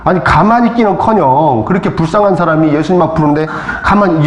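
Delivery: ordinary delivery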